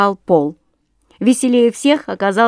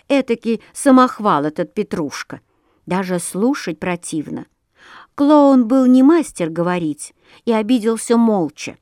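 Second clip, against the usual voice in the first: plain reading voice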